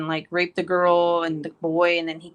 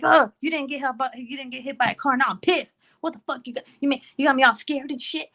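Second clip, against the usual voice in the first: higher, hammed-up relieved scolding; mock scolding; 7 semitones higher than usual. Mom telling her kid he scared her, mock anger. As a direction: chew the kid out with playful outrage. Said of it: "and I'm like".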